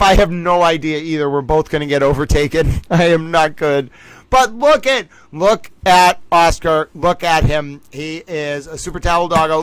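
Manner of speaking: normal talking voice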